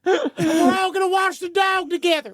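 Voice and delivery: high, shrill yelling dad upstairs. Pretend. Mocking a vampire dad calling his son Scott upstairs, high hollering yell. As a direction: holler for Scott like a hillbilly father. Continuing mock dad call.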